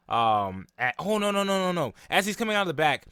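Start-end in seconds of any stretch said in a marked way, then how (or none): none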